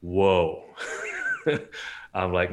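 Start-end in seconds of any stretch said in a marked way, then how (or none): 0.7-2.1 a quick breathy laugh at himself; short laugh. Real, not acted. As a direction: laugh off the memory. Laughs at the memory.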